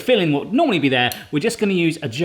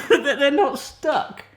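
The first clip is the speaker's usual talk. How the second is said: laughing; 10 semitones higher, much slower than usual. Real, not acted. Hoping tarts come out.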